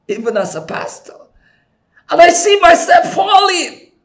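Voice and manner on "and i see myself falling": shout. Real, not acted. Preacher shouts climax.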